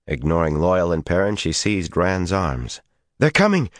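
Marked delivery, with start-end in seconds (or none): 3.2-3.7 breathless, out of breath; panting. A narrator's reading. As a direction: pant it out high. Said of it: "she panted".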